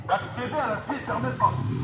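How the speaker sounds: usual speaking voice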